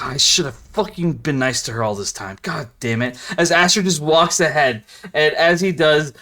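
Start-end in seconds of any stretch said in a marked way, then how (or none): 0.0-3.2 soft, low grumble to self; regretful aside. Acted. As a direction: sulk under your breath. Ash muttering regret aside.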